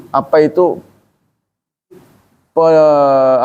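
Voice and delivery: normal talking voice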